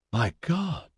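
hoarse, stunned gasp of words. A narrator's reading. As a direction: rasp it out in shock. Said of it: "Tundi said hoarsely".

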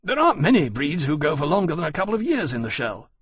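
lightly voiced earnest officer; a touch of urgency in the explanation; explaining officer. A narrator's reading. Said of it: "he explained".

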